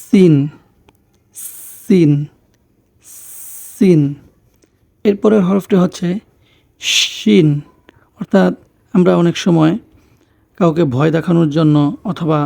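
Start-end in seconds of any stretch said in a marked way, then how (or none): 0.0-4.0 সিন opened with a long hissed s; সিন as "sssin"; sizzling S. Shown as a demonstration. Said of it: "সেই আওয়াজটা হবে". 6.8-7.5 শিন opened with a long sh hiss; শিন as "shhhin"; snake-like SH. Shown as a demonstration. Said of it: "সাপের মতো একটা আওয়াজ হবে".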